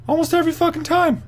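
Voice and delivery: squeaky high burst of disbelief; incredulous high-pitched emphasis; excited. Real, not acted. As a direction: shoot the voice way up in amazement. Marvelling at an underdog's win streak.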